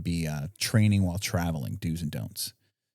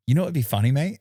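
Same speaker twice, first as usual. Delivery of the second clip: deliberately bad Australian accent, higher; botched Australian accent; 7 semitones higher, 4 dB louder, faster than usual. Host botching an Australian accent for laughs, higher-pitched. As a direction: ham up a clumsy Aussie voice. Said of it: "botch this fucking Australian accent".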